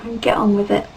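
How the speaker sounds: usual speaking voice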